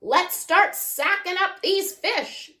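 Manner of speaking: lightly voiced grandpa calling across water; mild bellow. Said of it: "Grandpa bellowed over the roaring".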